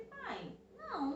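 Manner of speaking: startled refusal. Retold or imitated. Daughter refusing father's odd advice.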